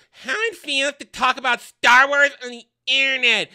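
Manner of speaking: a sarcastic high complaining voice; mocking impression. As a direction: put on a whiny voice to mock him.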